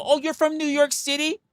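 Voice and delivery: high, awed "oh" of someone dazzled; impressed fan voice. Retold or imitated. Mock fan gushing at a New York City native. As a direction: gush like a fan meeting a New Yorker. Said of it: "and they go".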